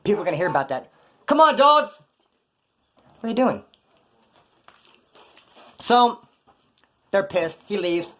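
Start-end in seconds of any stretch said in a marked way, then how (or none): 1.3-1.9 high, loud exasperated call; loud mock-incredulous protest; exasperated appeal. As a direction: call them out, pitched up and louder.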